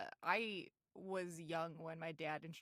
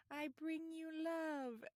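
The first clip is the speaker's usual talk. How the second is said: alien voice; 8 semitones higher, 5 dB quieter, slower than usual. Retold or imitated. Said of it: "Burns as the alien goes".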